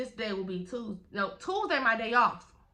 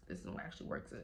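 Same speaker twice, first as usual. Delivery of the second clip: whispered aside; 14 semitones lower, 12 dB quieter than usual. Shown as a demonstration. Showing viewers the new brace, whispered aside. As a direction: repeat it in a low hush.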